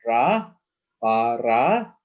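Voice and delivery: RA held long, then BA-RA drawn out; syllable by syllable. Shown as a demonstration. Teacher showing another syllable, sounded out slowly. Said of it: "and then another syllable".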